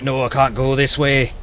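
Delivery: slow put-on Scottish accent; amateur Scots impression. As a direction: say it in a drawn-out mock Scottish accent. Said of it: "Excuse my Scottish accent".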